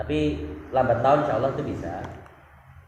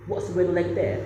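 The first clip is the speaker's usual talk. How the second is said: imitating a Filipina speaker's polished British-style English, pitched higher; a higher, posh-sounding British take on the question; Filipina speaker's British accent; 7 semitones higher, 3 dB louder than usual. Imitating a Filipina host asking about the weather, British accent. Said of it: "Gitu kan dia".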